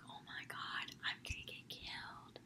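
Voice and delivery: very soft, voiceless mock panic; hushed, dread-filled whisper, acted out; panicked whisper. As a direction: whisper a retold panic to a friend. Confides panic to friend while riding off.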